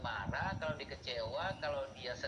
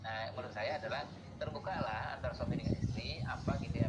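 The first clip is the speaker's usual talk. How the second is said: ordinary talk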